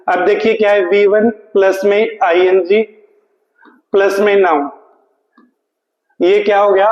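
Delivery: usual speaking voice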